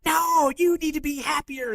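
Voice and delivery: high, slow, loud insistence; pushy excitement. Retold or imitated. Husband insisting his wife be happier, loud high plea. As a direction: demand she cheer up, loud and high. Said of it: "And I'm like".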